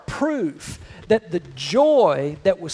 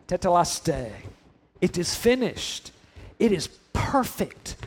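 quiet, content sigh of a finished painting; satisfied artist; 3 semitones lower, 5 dB quieter than usual. Retold or imitated. Artist stepping back from the painting, softly content. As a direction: step back and admire it softly. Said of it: "stepping away from their painting and going".

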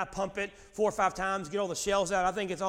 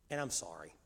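low, hushed and contrite; quiet, dropped-down admission; soft apology; 13 semitones lower, 10 dB quieter than usual. Acted. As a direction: say sorry humbly to your kids. Models apologizing to his children.

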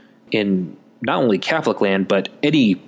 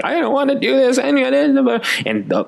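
put-on high whiny toddler voice, pitched way up; crying kid; 13 semitones higher, slower than usual. Pouting toddler refusing the time-out, high crying whine. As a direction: whimper it like a pouting little kid. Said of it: "the kid pouts and's crying".

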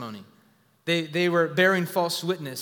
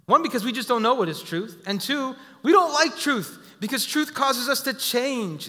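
higher, louder preaching build on "truth"; fiery preaching; 6 semitones higher, 4 dB louder than usual. Acted. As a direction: drive the point home from the pulpit.

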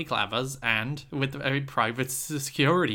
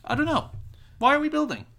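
exasperated; 4 dB louder than usual. Real, not acted. Frustrated about housing.

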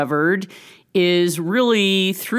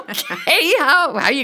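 laughs through a high "okay"; amused disbelief, laughing; 11 semitones higher than usual. Real, not acted. Laughing at daughter's plans.

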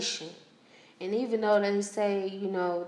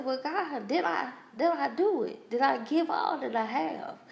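higher, earnest plea; a higher, searching prayer; pleading with God; 6 semitones higher than usual. Retold or imitated. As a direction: ask God anxiously, voice held higher. Asking God in a retold prayer.

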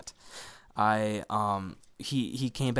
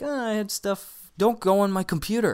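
upset teacher; 9 semitones higher, 5 dB louder than usual. Retold or imitated. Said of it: "So he's just like".

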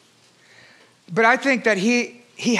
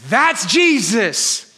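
full-force preaching; 6 semitones higher than usual. Acted. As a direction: preach it out big to the congregation.

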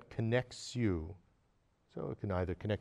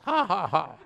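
loud, higher, knowing chuckle; knowing laugh; 8 semitones higher, 11 dB louder than usual. Retold or imitated. Child reincarnation teasing the visitor over biscuits, knowing laugh. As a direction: laugh as if you've seen right through him. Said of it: "and smiles".

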